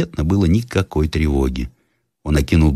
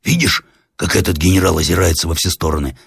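usual speaking voice

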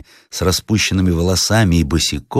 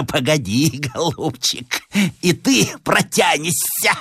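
very high, slow, hoarse threat; threatening madwoman; 11 semitones higher, slower than usual. Acted. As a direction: warn him with a crazed cackle. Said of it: "сказала охриплым голосом". (полоумная грозит охриплым голосом)

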